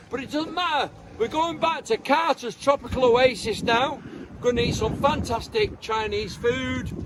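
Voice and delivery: ordinary talk, nothing marked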